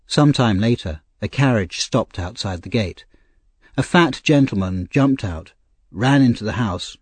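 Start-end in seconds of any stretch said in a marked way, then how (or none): none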